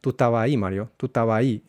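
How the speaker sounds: estabas said as taba, S and B lost; tú estabas ahí run together as tu taba i; Chilean estabas. Shown as a demonstration. Teacher modelling the dropped S and B in estabas. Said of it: "La E S de estabas se pierde".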